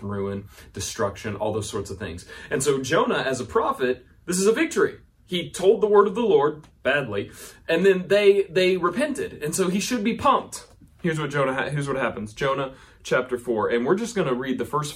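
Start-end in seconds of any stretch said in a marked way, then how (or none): 4.3-10.7 high, loud and pumped up; rising excitement over a win; excited. Real, not acted. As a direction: sell the victory with big energy. Preacher hyping the victory.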